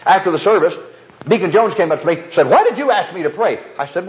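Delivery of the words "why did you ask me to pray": high-pitched acted demand for an explanation; retold complaint, pitched well up; indignant confrontation. Deacon confronting the preacher after the service, high and indignant.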